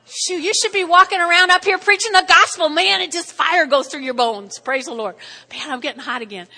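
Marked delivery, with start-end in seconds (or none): 0.1-4.6 loud, high, fired-up preaching; fiery preaching. Acted. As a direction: let the fire rise in your voice. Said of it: "does it feel like it's getting hot in here".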